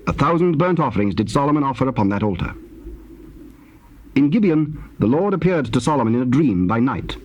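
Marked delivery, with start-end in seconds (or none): none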